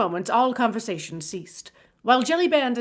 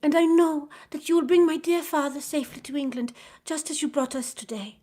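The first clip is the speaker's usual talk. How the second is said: put-on young girl; 7 semitones higher than usual. A narrator's reading. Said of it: "said Suzanne".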